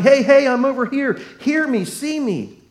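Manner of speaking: hammed-up beggar yelling for attention; a blind man shouting to be noticed over the crowd; mock shout. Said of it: "busy shouting".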